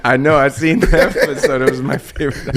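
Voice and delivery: giggling about a favorite episode; laughing. Real, not acted. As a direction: chuckle it out, amused. Laughing while recalling.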